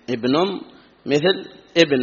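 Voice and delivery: ordinary talk, nothing marked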